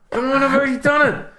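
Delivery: high-pitched whine; whiny high voice. Retold or imitated. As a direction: complain in a squeaky voice.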